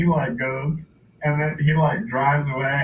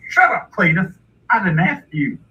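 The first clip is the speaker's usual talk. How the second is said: higher, louder snub to the son; hillbilly father; 5 semitones higher, 4 dB louder, faster than usual. Retold or imitated. Pa silences his son Cletus, gruff hillbilly rebuke. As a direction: shut the boy down like a rube dad. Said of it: "And then he's like".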